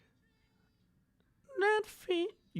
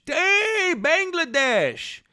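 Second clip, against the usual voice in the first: excited greeting; 10 semitones higher, much slower than usual. Real, not acted. Greeting a chatter's country.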